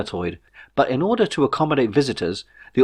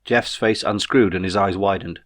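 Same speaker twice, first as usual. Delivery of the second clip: ordinary delivery, nothing marked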